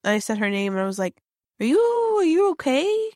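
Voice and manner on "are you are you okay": high, rising careful question; cautious, high-pitched check-in; tentative concern. Retold or imitated. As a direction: gingerly check on a child, voice way up. Checking on a staring child.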